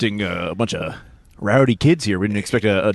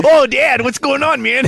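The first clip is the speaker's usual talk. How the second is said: Danny Trejo impression; 7 semitones higher, slower than usual. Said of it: "weird Danny Trejo impression".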